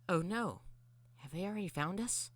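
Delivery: high, worried character voice; high voice of a concerned little robot; worried Mangle voice. Acted. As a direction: pitch it up in mild alarm. Mangle alarmed at news.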